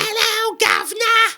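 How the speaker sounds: high, hammed-up Cockney British accent; a silly high British greeting in a Cockney accent; mock British accent. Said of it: "it's in a British accent".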